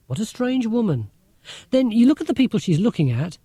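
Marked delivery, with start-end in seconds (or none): 0.1-1.1 1 imitating Mum.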